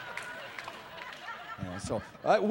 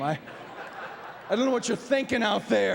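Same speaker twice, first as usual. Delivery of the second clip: usual speaking voice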